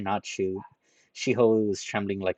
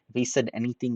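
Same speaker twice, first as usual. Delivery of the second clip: ordinary delivery, nothing marked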